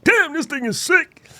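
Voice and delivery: high, drawn-out mock amazement; slow, high-pitched rave; amazed reaction. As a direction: exclaim it like a thrilled new user. Voices users' delighted reaction.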